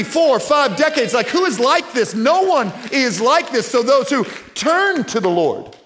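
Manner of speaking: high, loud, ringing preacher's cry; rising preaching at full throttle; full-force preaching. Real, not acted. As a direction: lift the room with wonder and conviction.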